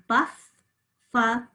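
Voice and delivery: Buff-fa-lo in three slow, separate syllables. Shown as a demonstration. Teacher does buffalo again for spelling, syllable by syllable. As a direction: break Buff-fa-lo apart slowly, fa a schwa. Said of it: "Let's just do buffalo again".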